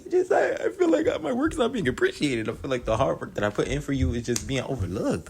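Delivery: high whiny imitation of crying; mock crying. Drea breaking down to Jocelyn, mock high teary whine. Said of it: "Drea starts breaking down, crying".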